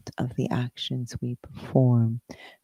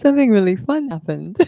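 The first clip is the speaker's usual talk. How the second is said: high, louder, breaking into laughter; real amusement; 10 semitones higher, 5 dB louder, faster than usual. Real, not acted. Speaker explaining a funny interruption to the co-host, laughing. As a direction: say it tickled, laughing at the end. Amused by monitor messages mid-talk.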